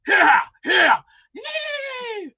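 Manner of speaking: high, loud hammed-up cowboy yell; mock cowboy whoop. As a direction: holler it like a rowdy cowboy, clowning. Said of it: "and going, like".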